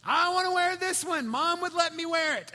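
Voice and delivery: high, loud imitation of a protesting little kid; whiny child's protest. Daughter arguing with dad over her nightgown, high loud mock protest.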